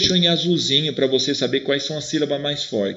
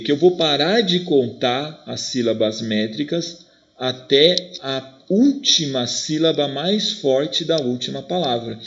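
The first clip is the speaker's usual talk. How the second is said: ordinary delivery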